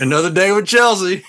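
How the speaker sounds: amused, resigned; laughing weary sigh. Retold or imitated. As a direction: chuckle through it. Mock weary husband thought.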